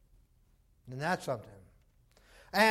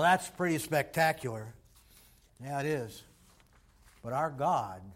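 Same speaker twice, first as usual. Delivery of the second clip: ordinary talk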